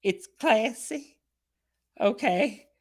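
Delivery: high, loud, unsure voice with a laugh; woman persuading herself to pose, high and unsure; mock nervous woman. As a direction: talk yourself into it, half-laughing. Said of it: "And she's like".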